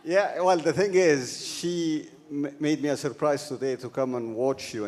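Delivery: ordinary delivery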